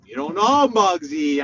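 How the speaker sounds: usual speaking voice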